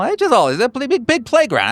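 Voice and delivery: high-pitched placating excuse; hammed-up backpedaling. Man excusing his teasing to a marshal, high placating voice. Acted denial to accusing marshal.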